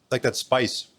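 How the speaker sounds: ordinary talk, nothing marked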